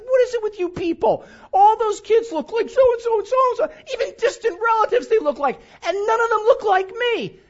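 high, whiny mock grievance, pitched well up; hammed-up high-pitched complaining; mock complaint. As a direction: play up a hurt, whining husband. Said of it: "I've complained to her privately".

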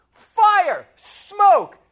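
booming, high, very loud list; explosive, separated punches; loud wizard thunder. Acted. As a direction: thunder each word like the angry wizard. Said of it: "loud voice".

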